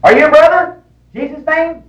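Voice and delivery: high-pitched eager questions; high, surprised mock delight; excited greeting, imitated. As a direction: act out an overjoyed welcome. Acts out eager greeting of preacher.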